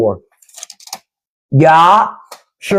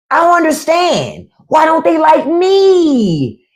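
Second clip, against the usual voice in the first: high, slow, whining, half-crying mock plea; whiny mock plea; 6 semitones higher, slower than usual. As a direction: beg like a spoiled child who doesn't get why he's unloved. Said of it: "That's what he wanna say".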